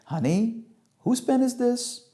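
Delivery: high, slow motherly question; mum's leading question, drawn out; mother quizzing child. Acted. Mother sweetly quizzing her child.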